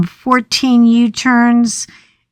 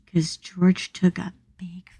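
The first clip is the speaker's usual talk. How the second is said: whisper; 10 dB quieter than usual. Real, not acted. Gross detail, whispered.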